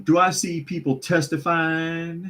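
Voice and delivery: high-pitched mock Southern preacher call; mock Southern drawl. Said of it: "dropping into a drawl".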